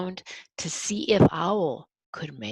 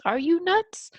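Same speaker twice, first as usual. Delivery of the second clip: high squirrel voice; 11 semitones higher, 4 dB louder than usual. Acted. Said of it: "squirrel ... he says".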